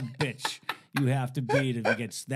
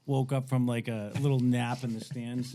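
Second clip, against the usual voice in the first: ordinary talk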